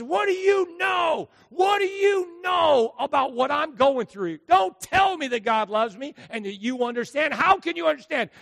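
a grieving mother screaming at the pastor; grieving woman's scream. Retold or imitated. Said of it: "she screamed at the pastor".

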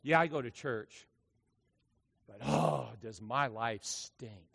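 sinking to a soft, slow groan; deflated, grumbling believer, lightly played; a mock moan sliding low and slow; mock gloomy churchgoer. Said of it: "where people go".

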